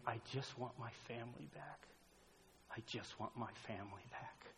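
very soft, hushed imitation of a hurting son; retold crying son. Father retelling his son's plea on the ground, hushed sorrow. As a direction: drop almost to nothing, voicing his plea. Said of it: "was my eighteen-year-old son crying".

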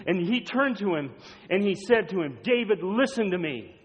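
loud, high-pitched pulpit storytelling; fervent preaching. Acted. Preacher telling how David tried covering up with Uriah, fervent. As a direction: drive the story forward with forceful, urgent emphasis.

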